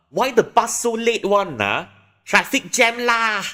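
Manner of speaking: high, loud Manglish grumble; Malaysian complaint. Shown as a demonstration. Waiting for a late bus, loud high Malaysian complaint. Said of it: "you can say".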